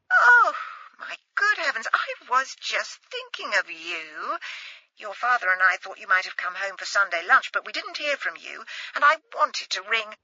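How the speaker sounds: a timid posh mother, delighted to hear her daughter. Acted. Mother voiced on the phone.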